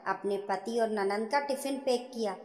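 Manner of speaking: ordinary delivery, nothing marked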